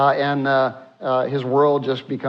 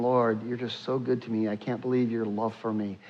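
soft praise prayer; 3 semitones lower, 9 dB quieter than usual. Acted. Voiced fellowship prayer.

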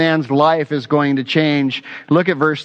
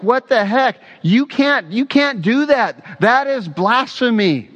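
high-pitched, incredulous protest; upset objection; 8 semitones higher than usual. Acted. Religious leaders confronting Jesus, upset and high-pitched. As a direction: object in a raised pitch, mildly scandalized. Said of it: "correct in being upset".

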